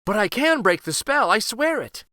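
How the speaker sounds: crying out. Acted. Katya crying out her vow to the scornful Vizier. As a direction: insist with all her heart that she can do it. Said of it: "cried Katya".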